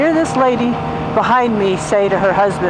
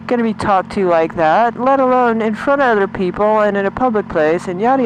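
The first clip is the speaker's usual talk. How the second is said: normal talking voice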